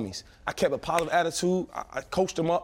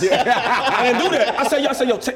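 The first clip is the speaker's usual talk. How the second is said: laughing; 6 semitones higher, 7 dB louder than usual. Real, not acted. Retelling the locker room story to the hosts, laughing. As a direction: laugh through it, amused at himself.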